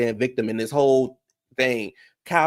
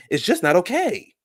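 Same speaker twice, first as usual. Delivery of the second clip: retold scolding; 7 semitones higher than usual.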